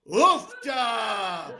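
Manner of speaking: "Ufta" said OOF-tah, loud and punchy; Norwegian word. Shown as a demonstration. Auctioneer teaching guests a Norwegian word, punchy "Ufta". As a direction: belt out "Ufta" with a falling tune. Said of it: "get ready, is".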